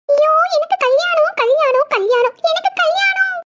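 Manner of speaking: high, drawn-out excited singsong; acted overjoyed squeal; giddy delight. Bride rejoicing over her wedding.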